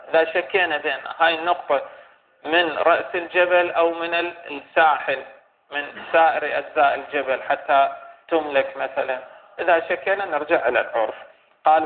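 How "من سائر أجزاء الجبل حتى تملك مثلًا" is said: ordinary delivery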